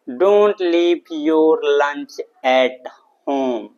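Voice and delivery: very slow, each word stretched out and separated; slow model sentence. Shown as a demonstration. English teacher giving students the answer, slowly and deliberately drawn out. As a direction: dictate it word by word for learners.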